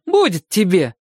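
exaggerated rustic accent, pitched high; hammed-up country drawl, scolding the hen; overdone foreign pronunciation. A narrator's reading. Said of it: "говорит с нарочитым акцентом".

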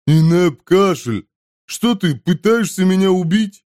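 high, complaining character voice; woken glutton. A narrator's reading. Glutton complains to the man slapping him, high protest. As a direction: protest at being roused.

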